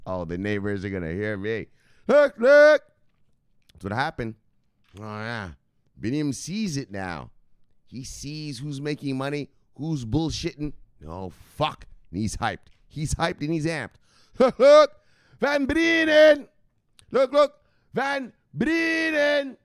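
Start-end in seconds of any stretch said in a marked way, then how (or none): 2.1-2.8 sudden loud high "look, look"; mock shout. Hyped husband yelling at wife, loud and high. Character bit still going. 14.4-19.6 loud, high, drawn-out "Van Brienen"; hyped yelling. Acted. Streamer yelling a name at chat, loud and high. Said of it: "I'll just sit here yelling Van Brienen".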